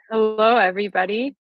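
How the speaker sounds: usual speaking voice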